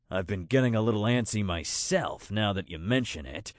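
low-pitched creature voice; Snowclaw, restless but amiable. Acted. Snowclaw admits to friends he's antsy, low amiable creature voice. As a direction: play an amiable big beast, pitched low.

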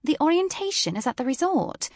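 British woman voice. A narrator's reading.

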